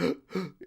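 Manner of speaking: short acted gasps from the cold; imitated cold-shock panting; ice-shock gasp. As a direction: catch your breath as if in icy water. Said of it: "you're just like".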